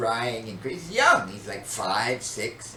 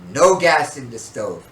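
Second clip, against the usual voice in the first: loud, slow paternal command; father's rule; 9 dB louder than usual. Retold or imitated. Father forbidding his children, loud stern rule. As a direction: lay down the law slowly and loudly. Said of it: "my father had always said".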